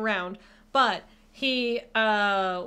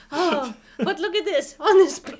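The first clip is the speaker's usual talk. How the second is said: hammed-up ad pitch; 3 semitones higher, 4 dB louder than usual. Streamer improvising a Venice boat ad, hammed-up. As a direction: gush grandly through giggles.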